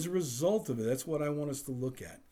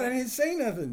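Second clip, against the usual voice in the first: high, loud protest; mock innocent protest; 12 semitones higher, 4 dB louder than usual. Husband protesting innocence to his wife, high and loud. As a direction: squeak out your innocence. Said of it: "I'm like".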